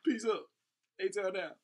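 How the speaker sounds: high and loud like a hype man; hype shout-out. Real, not acted. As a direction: shout it out to the hometown crowd.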